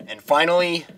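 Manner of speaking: ordinary talk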